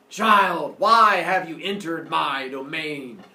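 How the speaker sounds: loud, slow booming pronouncement; commanding god. Acted. Sun god challenging an intruder, loud slow command. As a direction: stretch the words out imperiously. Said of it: "Hears a voice".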